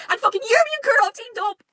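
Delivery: real high, loud, drawn-out fan squeal; freaking out. Real, not acted. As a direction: shriek the big reveal with excitement. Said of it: "I freaked out when I saw this".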